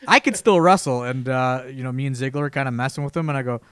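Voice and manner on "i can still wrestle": louder, higher mock protest breaking into a laugh; riled up. Retired wrestler insisting he can still wrestle, louder, higher, half-laughing. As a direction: imitate a buddy getting worked up, half-laughing. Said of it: "getting himself real, real riled up".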